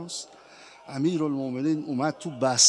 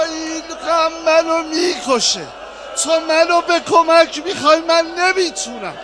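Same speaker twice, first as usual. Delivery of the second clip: full-force lament; 9 semitones higher, 10 dB louder than usual. Acted. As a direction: wail the climax to the mourners. Climax of martyrdom lament.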